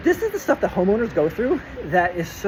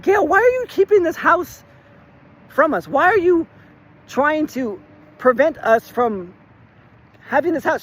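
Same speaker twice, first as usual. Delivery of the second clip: high, louder, pleading outrage; exasperated plea; 9 semitones higher, 4 dB louder, slower than usual. Retold or imitated. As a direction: demand to know why she's blocking you.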